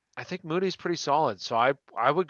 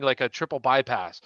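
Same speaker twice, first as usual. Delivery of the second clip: ordinary talk, nothing marked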